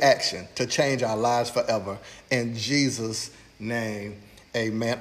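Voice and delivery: preacher praying before his message; prayer. Acted. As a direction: pray it over the congregation, reverent. Leads a prayer.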